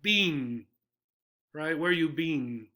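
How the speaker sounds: "been" said as bean, long e. Shown as a demonstration. Teacher showing the long-E "been". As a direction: say "Where you been?" with bean. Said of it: "they make the two E's a long E sound".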